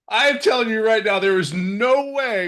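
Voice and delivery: giggling through a joking boast; laughing. Real, not acted. To the group about his pink shirt, laughing.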